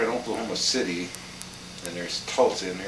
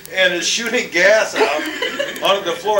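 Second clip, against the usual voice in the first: laughing; 11 semitones higher, 5 dB louder than usual. Real, not acted. Laughing while telling story.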